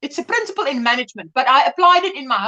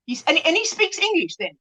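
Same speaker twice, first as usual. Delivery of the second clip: ordinary talk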